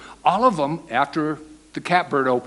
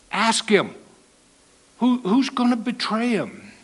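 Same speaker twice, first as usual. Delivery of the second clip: higher, urgent prompting; urgent whisper-ask; 7 semitones higher than usual. Retold or imitated. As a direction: nudge him to ask, voice raised in pitch. Peter signals John, acted quote.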